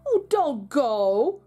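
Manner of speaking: high, slow, drawn-out plea; pleading. A narrator's reading. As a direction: beg them to stay. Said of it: "Herschel pleaded".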